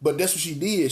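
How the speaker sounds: normal talking voice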